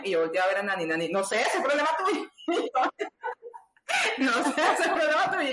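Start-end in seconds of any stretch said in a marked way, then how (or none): 1.1-2.3 higher, mock-offhand friend voice; teasing friend. Guest imitating Nani brushing off the surgeon, mock-offhand. Quoting Nani's teasing reply. 2.5-5.5 higher mock-offhand, breaking into laughter; laughing. Guest repeating Nani's teasing line, laughing.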